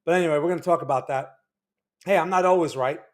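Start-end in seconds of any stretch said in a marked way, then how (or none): none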